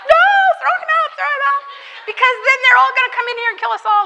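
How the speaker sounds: high, fast, shrill panic; panicked shriek. Retold or imitated. Pastor's wife demanding the stranger be thrown out, high fast panic. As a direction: shriek at her to throw him out. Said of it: "and she's like".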